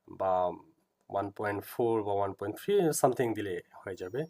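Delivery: ordinary talk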